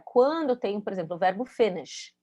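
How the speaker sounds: ordinary delivery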